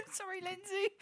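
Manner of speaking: laughing apology. Real, not acted. Streamer apologising to a viewer, giggling. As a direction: squeak the apology while laughing.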